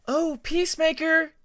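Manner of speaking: high, loud call across the room; sing-song call to get his attention. Acted. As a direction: call his name from behind, bright and loud. Voice calls from behind him.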